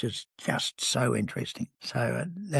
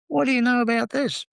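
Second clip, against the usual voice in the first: high, loud mock accusation; suspicious question; 10 semitones higher, 4 dB louder than usual. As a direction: pin the wife down playfully. Suspicious question to wife Helen.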